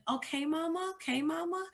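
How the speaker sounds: put-on high, sweet small-girl voice; lightly voiced little girl calling mama; little daughter's voice. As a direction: imitate your daughter soothing you. Daughter soothing crying mother.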